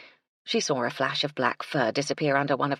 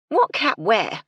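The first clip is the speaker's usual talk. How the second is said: demanding; 6 semitones higher, 7 dB louder, slower than usual. A narrator's reading. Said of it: "she demanded".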